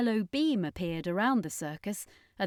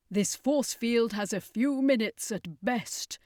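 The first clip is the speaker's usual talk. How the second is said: grimly; 4 semitones higher than usual. Acted. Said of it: "the circus master said grimly".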